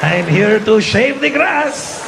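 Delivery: put-on very slow, heavy Mexican accent; hammed-up Mexican gardener greeting his boss; mock Mexican gardener. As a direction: drawl it out like a thick-accented gardener. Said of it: "My gardener".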